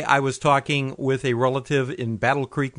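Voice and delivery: ordinary delivery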